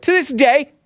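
high, ringing "To this day" drop; radio drop catchphrase. Shown as a demonstration. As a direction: sing out "To this day" high and bright. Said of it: "have you done the to this day drop".

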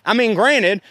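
ordinary talk

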